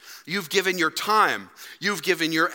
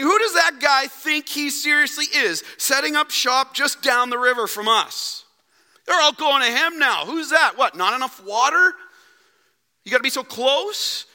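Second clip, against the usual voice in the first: high, louder scoffing voice; resentful disciples; 7 semitones higher, 3 dB louder than usual. Acted. Jealous disciples mocking the new preacher nearby, high and sneering. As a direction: sneer at the rival down the river. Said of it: "can you just hear them saying".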